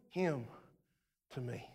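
him to me sinking low and soft; fading to a whisper. Acted. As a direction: let it die away. Trailing off.

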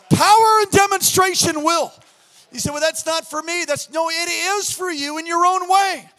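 loud, shouting sermon; fiery pulpit shouting; preaching at full force. Acted. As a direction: preach it hard to the congregation.

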